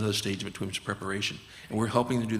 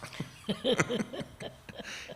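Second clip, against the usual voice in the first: high breathy laugh; 12 semitones higher, 5 dB quieter than usual. Real, not acted. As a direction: let out a high airy laugh. Laughter after story.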